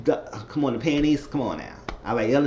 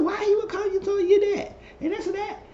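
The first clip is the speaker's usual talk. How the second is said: squeaky high mock outrage; mock high indignant voice. Retelling Aaron, high mock indignant question. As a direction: pitch way up, imitating him.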